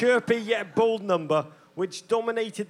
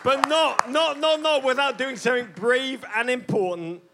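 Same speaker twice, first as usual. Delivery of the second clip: louder rousing tribute; stirring toast; 5 semitones higher, 4 dB louder than usual. Acted. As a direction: rouse the crowd proudly.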